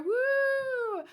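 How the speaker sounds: high gleeful woo while spinning; excited whoop. Real, not acted. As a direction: let out a happy cheer. Spinning in chair, cheering.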